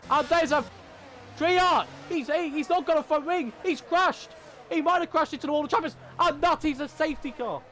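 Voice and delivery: high-pitched, excited crash call; worked-up race commentator; excited shouting commentary. Real, not acted. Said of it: "I'm not allowed to shout anymore".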